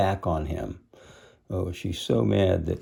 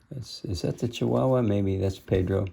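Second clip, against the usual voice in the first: ordinary delivery, nothing marked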